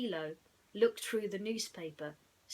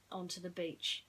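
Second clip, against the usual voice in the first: plain reading voice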